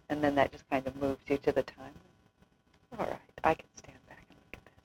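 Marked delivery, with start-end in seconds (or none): 3.0-4.7 inner whisper. Retold or imitated. Inner thought, stepping back.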